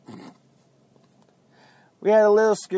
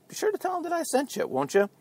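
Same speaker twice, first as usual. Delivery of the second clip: mock sweet; 10 semitones higher, 8 dB quieter, faster than usual. Cheeky aside to listeners.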